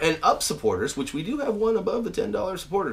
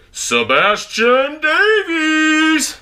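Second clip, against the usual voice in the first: slow, drawn-out sung shout, much higher and louder; 12 semitones higher, 7 dB louder, much slower than usual. Real, not acted. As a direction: belt the name like an announcer across the house. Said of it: "I'm gonna go ahead and shout all these out".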